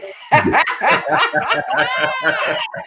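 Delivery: long burst of helpless laughter. Real, not acted. Cracking up over her jumping when caught, long laughing. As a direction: keep cackling at the joke. Laughing at joke about farting.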